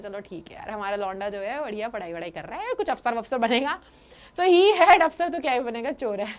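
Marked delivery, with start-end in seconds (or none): 0.0-3.8 soft, pleased murmur to himself; a quiet, satisfied chuckle of a thought; smug smiling aside. Retold or imitated. Governor musing to himself about his prisoner, softly and smugly. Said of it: "मुस्कुरा रहा होता है".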